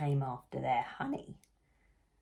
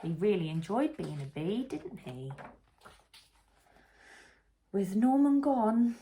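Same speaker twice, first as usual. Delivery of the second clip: plain reading voice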